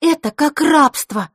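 slow, loud and high declaration; decisive. Acted. Scene: Vasilisa condemning the hostess, slow loud outburst. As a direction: state it firmly and slowly. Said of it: "решительно произнесла Василиса".

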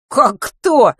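high, loud, affronted question; indignant. A narrator's reading. Boy to mother who asks who is calling, indignant. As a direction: bristle at not being recognised. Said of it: "возмутился Матвей".

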